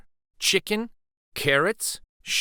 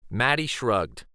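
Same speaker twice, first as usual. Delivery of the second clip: usual speaking voice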